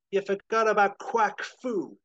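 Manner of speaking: loud, high triumphant line; dramatic reveal. Acted. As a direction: announce it like a hero.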